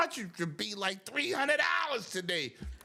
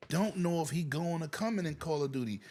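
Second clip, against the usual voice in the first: ordinary talk, nothing marked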